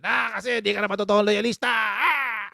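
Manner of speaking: hammed-up, high, loud accusation; mock offended. Imitating offended followers accusing the host, mock outrage. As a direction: imitate an outraged critic raising the voice. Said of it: "may mga mao-offend na naman sa akin".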